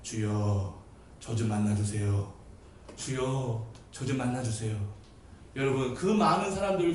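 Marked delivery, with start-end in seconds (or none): 0.0-4.8 low, soft, restrained plea; overly genteel prayer. Acted. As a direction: pray too politely to be heard. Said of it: "그렇게 점잖해서". (점잖고 나직한 기도 흉내)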